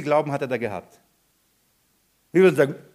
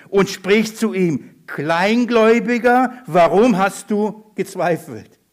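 slow, weighty recitation, raised voice; emphatic scripture reading; 3 semitones higher, 5 dB louder, slower than usual. A narrator's reading. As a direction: read it out deliberately, stretching key words. (langsame, nachdrückliche Bibellesung)

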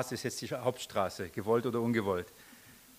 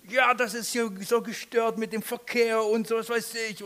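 higher, mock-angry neighbour shouting back; 6 semitones higher than usual. Neighbour yelling back at the preacher, mock angry. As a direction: play the raging neighbour, pitched up. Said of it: "brüllt mich zurück".

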